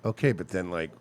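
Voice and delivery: calm questioning. Retold or imitated. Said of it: "you're just calmly being like".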